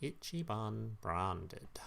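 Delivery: slow, soft mock Japanese accent; drawn-out, hushed imitation of a Japanese accent. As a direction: say it slowly and quietly with a Japanese accent. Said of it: "you know, Japanese voice".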